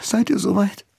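trembling, close to tears; shaky voice. A narrator's reading. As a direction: voice quivering with held-back tears. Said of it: "Mit bebender Stimme".

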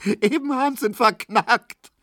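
high, gleeful teasing with a laugh edge. A narrator's reading. Tesch telling Markowski of Kubinke's plight, laughing. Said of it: "rief Tesch lachend".

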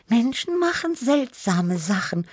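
high little cat voice, pondering; Tibi. A narrator's reading. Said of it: "überlegte Tibi". (hohe Katzenstimme, nachdenklich)

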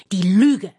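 loud, emphatic disgust; loud, outraged emphasis; indignant outburst. A narrator's reading. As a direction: throw the word out angrily. Indignant outburst against lying.

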